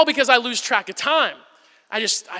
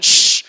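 forceful hiss of a shush; really loud shush; 7 dB louder than usual. Retold or imitated. Dad shushing his son's face, really loud shush. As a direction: shush hard right in someone's face. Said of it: "like, really loud in his face".

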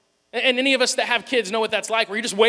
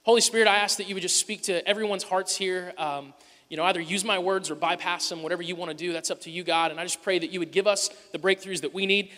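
prayer; 3 semitones lower than usual. Real, not acted. Pastor praying before sermon.

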